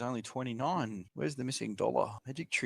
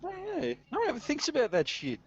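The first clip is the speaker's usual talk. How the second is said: mock high voice. Giddy high mock agreement.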